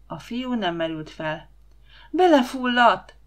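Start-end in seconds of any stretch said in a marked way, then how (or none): 2.1-3.2 loud, high, slow cry of alarm; a panicked girl crying out that he drowned; frightened cry. A narrator's reading. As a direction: shout it in sudden fright. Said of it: "kiáltotta rémülten".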